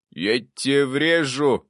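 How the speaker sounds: loud, drawn-out indignation; outraged ghostly ancestor; indignant. A narrator's reading. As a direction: raise the pitch and stretch the warning. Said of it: "возмутился предок".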